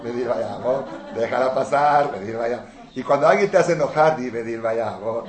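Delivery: ordinary talk, nothing marked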